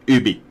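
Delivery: "Ubi" said OO-bee; French-style pronunciation. Shown as a demonstration. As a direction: open "Ubi" on a pure OO, no Y. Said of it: "it's pronounced".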